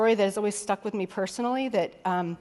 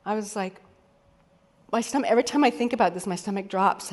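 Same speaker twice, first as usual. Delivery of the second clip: usual speaking voice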